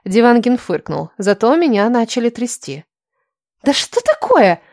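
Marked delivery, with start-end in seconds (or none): 3.6-4.6 higher, fretful lament; pitched-up whiny dismay; wailing complaint. A narrator's reading. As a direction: fret aloud in exasperation. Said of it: "запричитала Лиля".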